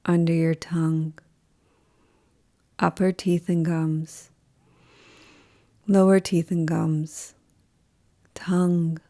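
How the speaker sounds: slow, calm yoga nidra voice with long pauses; guided meditation. Acted. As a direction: guide them unhurriedly into rest, leaving space after each phrase.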